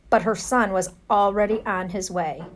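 ordinary delivery

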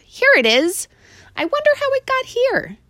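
put-on a child puzzling aloud; boy's voice. A narrator's reading. Boy finding his motorcycle, high curious voice. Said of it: "said the boy to himself".

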